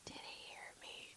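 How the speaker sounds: very soft, breath-only whisper. Retold or imitated. Checking with her friend that Bill Burr missed it, whispered. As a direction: whisper it barely audibly, as a secret question.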